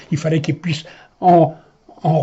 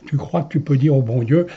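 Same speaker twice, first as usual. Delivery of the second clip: usual speaking voice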